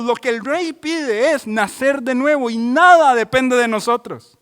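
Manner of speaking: impassioned sermon climax; fervent preaching. Acted. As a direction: build each line like a pulpit crescendo. Rising list, preached climax.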